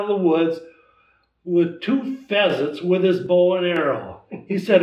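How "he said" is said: ordinary talk, nothing marked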